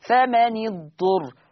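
فمن اضطر with the noon given a kasra, slow and drawn out; Hafs reading with kasra. Shown as a demonstration. Teacher reciting the Hafs reading slowly. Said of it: "عندنا لفظة فمن".

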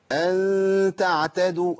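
أن تعتدوا slow, the ghunna of أن kept light with a slight smile; light ikhfa. Shown as a demonstration. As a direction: keep أن تعتدوا light and thin in contrast. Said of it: "هيئة بسيطة جدًا للشفتين".

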